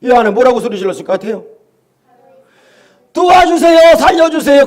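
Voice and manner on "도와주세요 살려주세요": high, loud plea for help; pleading shout. Retold or imitated. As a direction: cry out begging to be saved. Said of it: "그러지 않았겠어요?".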